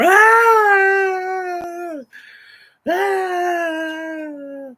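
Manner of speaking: put-on high, loud girlish scream; screaming like a little girl. Retelling the pepper burn to viewers, high shrieking scream. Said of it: "screaming and yelling like a little girl".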